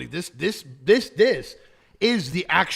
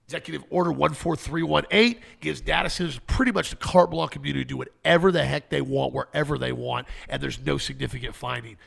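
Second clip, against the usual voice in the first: ordinary talk, nothing marked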